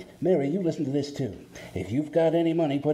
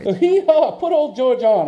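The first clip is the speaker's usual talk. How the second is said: loud, high, hearty; jovial loud greeting; 7 semitones higher, 8 dB louder than usual. Acted. Sam greeting his old pal, loud and jovial. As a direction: boom it down the line.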